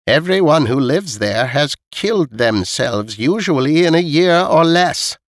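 put-on high, deliberate old lady voice; old woman spelling out a grim fact, pitched high; correcting old woman. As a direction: correct the listener firmly as an elderly neighbour. Voices old Missus Knight.

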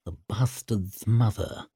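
muttered from the corner of the mouth; understated, grunted reply. A narrator's reading. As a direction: grunt it as a discreet aside. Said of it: "grunted Glocta from the corner of his mouth".

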